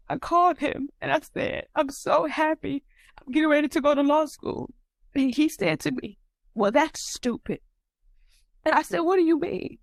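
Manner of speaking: high voice, welling up with real feeling; emotional. Real, not acted. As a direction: tell a still-painful memory, emotions rising. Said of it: "I'm gonna get emotional".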